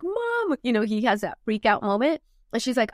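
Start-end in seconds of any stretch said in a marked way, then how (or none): 0.0-0.6 put-on child calling mom. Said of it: "He lifts up his head and is like".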